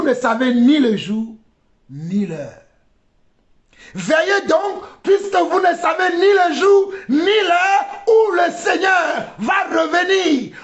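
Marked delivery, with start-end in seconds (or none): 3.9-10.6 high, ringing preacher's shout; urgent high-pitched exhortation; impassioned preaching. Real, not acted. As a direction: proclaim the verse to the congregation. Same verse preached with force.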